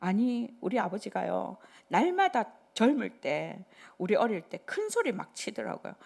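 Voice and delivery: ordinary talk, nothing marked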